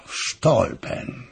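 slow, breathy murmur of "w Stolpen"; brooding half-whisper, drawn out; murmured to himself. Acted. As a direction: let "w Stolpen" sink into a hushed, unvoiced breath. King repeats the name to himself.

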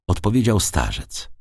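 usual speaking voice

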